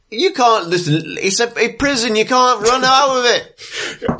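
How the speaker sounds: a flustered guard pleading with escapees; exasperated guard. Acted. The guard calling after the fleeing prisoners, exasperated. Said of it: "the guard's like".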